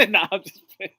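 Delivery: talking through laughter; laughing it off. Real, not acted. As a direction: break into a laugh while backing off the joke. Laughs off his own joke.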